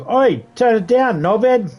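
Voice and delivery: high, loud shout across the yard; retold yell. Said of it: "I had yelled out a couple of times".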